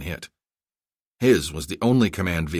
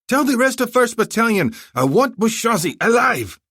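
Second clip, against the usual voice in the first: much higher, louder commanding shout; 6 dB louder than usual. Acted. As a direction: bark the order to the aide. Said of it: "he shouted to his aide".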